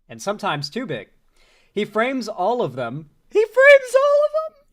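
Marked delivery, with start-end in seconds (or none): 3.3-4.6 very high, loud, slow squeal; imitated true emotion. Streamer showing how he says it, imitated emotional shriek. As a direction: shriek it in mock-anguished falsetto. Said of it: "with true emotion".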